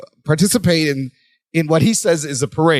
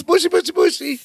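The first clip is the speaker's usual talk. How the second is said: very high sing-song; squeaky Balki voice; 13 semitones higher than usual. Retold or imitated. Echoing the Balki bit.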